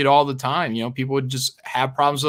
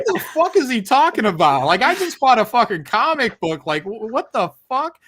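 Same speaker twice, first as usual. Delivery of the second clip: high, baffled mock customer; confused customer; 8 semitones higher than usual. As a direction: play a buyer totally lost. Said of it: "everyone, like".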